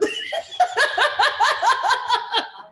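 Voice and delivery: long, loud, high peals of laughter; real laughing fit. Real, not acted. Host teased by a laughing friend, long high laughing fit. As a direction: crack up helplessly.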